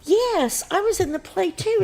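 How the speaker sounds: very high, eager answer; eager reply. Retold or imitated. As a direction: insist brightly she was in it too. Narrator's eager retold answer.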